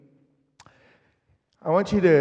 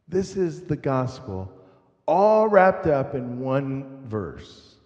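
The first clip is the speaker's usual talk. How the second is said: usual speaking voice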